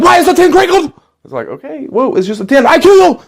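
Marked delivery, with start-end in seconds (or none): 0.0-0.9 furious German shout. Acted. Salesman erupts at customer. 2.7-3.2 furious German shout. Acted. Salesman threatens the customer.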